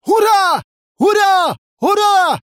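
very high, loud hurrahs; bellowed cheer. A narrator's reading. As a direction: yell it like three heads cheering. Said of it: "заорали в три горла головы Горыши".

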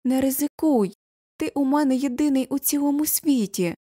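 high-pitched, urgent plea; pleading cry. A narrator's reading. Mother begs her only son not to risk it, crying out. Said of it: "кричить Юля".

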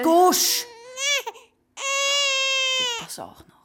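high crying outburst; furious crying rebuke; tearful snap. Acted. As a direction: shut her up through tears. Older girl snaps, crying.

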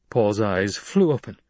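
plain reading voice

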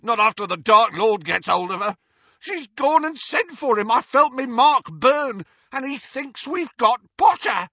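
high, loud panicked ranting. A narrator's reading. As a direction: rant in fear of the Dark Lord.

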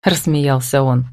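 usual speaking voice

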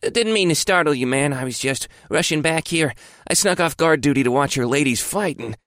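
high, eager guard voice; young guard voice. Acted. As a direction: play the guard, breathless and eager. Guard voiced in narrated story.

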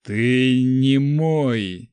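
very slow, wheezing through blood; hoarse wheeze. A narrator's reading. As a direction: rasp it out while dying. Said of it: "прохрипел он".

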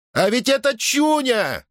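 high ringing falsetto, drawn out; ringing falsetto yell. A narrator's reading. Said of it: "каким-то звенящим фальцетом завопил". (звенящий фальцет)

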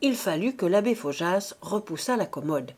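ordinary delivery

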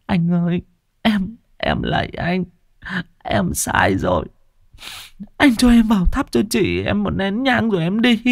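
high, tearful begging voice; whimpering plea. A narrator's reading. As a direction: plead through tears, voice high. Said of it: "bà Loan chỉ biết mếu máo". (giọng mếu máo van xin)

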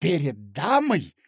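furious shout. Acted. Mozglyakov yelling at Marya Alexandrovna in fury. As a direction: spit it at her in outrage. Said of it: "вскричал Мозгляков".

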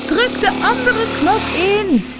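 high, drawn-out yell; very high, stretched-out cry; screamed instruction. A narrator's reading. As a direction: shout it slowly from afar over a roaring engine. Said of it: "schreeuwde Tina".

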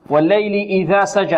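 إذا with the dhal, tongue tip between the teeth; clear dhal in إذا. Shown as a demonstration. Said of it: "إذا الذال ماذا".

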